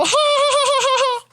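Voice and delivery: very high, loud cartoon laugh; clown giggle. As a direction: giggle like a squeaky clown bear. Clown bear greets the kids.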